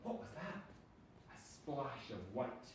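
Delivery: plain reading voice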